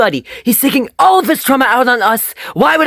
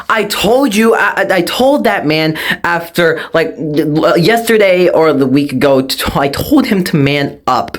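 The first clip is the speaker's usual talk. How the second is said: ordinary talk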